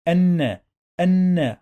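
الن as "an-na", high and loud; doubled noon. Shown as a demonstration. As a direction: sound the n in الن twice, no l. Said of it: "We pronounce نون two times".

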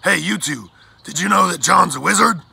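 loud, high excited shout; animated calling out. A narrator's reading. Blackbird telling passing pirates the secret, excitedly loud. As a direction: call out the big news. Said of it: "Blackbird pointed animatedly at John".

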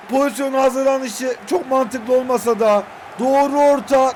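ordinary delivery

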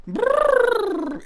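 high, rolling trill noise; trilled brrr. Real, not acted. Streamer announcing Horse Frick Valley, high trilled brrr. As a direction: roll a long, high brrr.

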